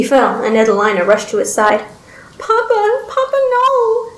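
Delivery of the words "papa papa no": put-on very high, drawn-out childlike wail; high-pitched anguished begging. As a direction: plead as a distraught little girl. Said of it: "she begged".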